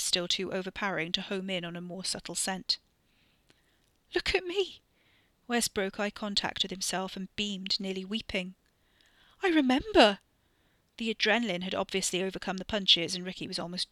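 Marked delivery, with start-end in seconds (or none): 4.1-4.8 very high delighted cry; overjoyed. Acted. Wes overjoyed at reflection. 9.4-10.2 high, loud elated shout; joyous cry. Acted. Wes nearly weeping, joyful.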